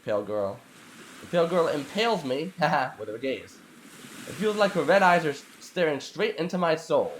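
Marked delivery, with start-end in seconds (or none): none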